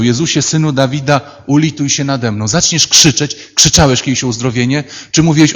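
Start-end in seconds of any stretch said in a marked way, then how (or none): none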